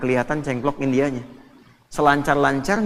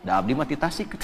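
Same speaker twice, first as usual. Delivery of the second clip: Sundanese reply, laughing; 3 semitones lower, 3 dB quieter than usual. Retold or imitated. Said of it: "kaifa haluk".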